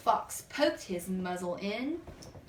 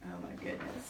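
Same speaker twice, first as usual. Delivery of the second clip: hushed amazement; 10 dB quieter, faster than usual. Acted. Reacting to mouse on bear.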